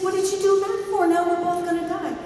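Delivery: high, shocked outcry; betrayed turtle crying out mid-river. Acted. Turtle bitten mid-river, high shocked cry. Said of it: "And he says".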